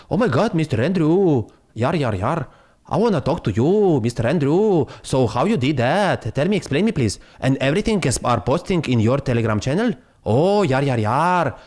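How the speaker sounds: light mock Indian accent, eager. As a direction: imitate the pleading texter from India. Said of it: "the guy from India will text me".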